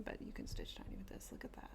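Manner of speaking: soft conspiratorial whisper. Real, not acted. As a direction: let them in on a secret. Confiding aside about thick thread.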